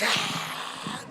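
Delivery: breathy, voiceless burst of laughter; head-back laugh. Shown as a demonstration. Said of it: "your head goes back".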